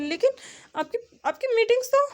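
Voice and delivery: quick, high, stammered protest; startled assistant, pitch jumping up; flustered objection. A narrator's reading. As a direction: blurt it out, hesitant and taken aback.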